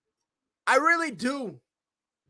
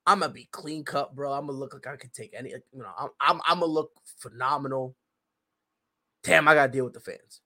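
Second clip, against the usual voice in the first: softer, lower musing to himself; a touch of a wrestler thinking aloud; imagined inner monologue; 7 semitones lower, 3 dB quieter than usual. Retold or imitated. Wrestler privately planning his move to another company. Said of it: "'Cause they know".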